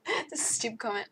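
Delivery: ordinary talk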